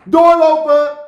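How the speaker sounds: very high, very loud shriek; screaming. Acted. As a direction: blast it at the old people. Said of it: "Zo oefende ze".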